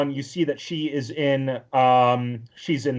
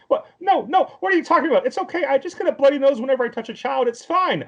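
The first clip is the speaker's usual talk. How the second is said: much higher, flustered protesting voice; high-pitched nervous backpedalling; panicked excuses; 13 semitones higher, 3 dB louder, faster than usual. Retold or imitated. Tomoya caught touching a kid insists it's fine, high panicky voice.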